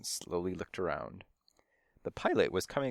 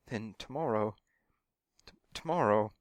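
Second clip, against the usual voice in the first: faltering dejection. A narrator's reading. Stunt cat dreading tomorrow's shoot, faltering and dejected. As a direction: stammer on the last word. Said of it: "He shook his head dejectedly".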